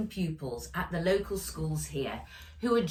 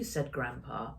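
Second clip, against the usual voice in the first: plain reading voice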